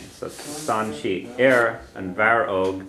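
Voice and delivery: slowly, word by word, Stan she and Aaron Varog spelled out in sound; slow model reading. Shown as a demonstration. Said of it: "teacher models the Irish slowly".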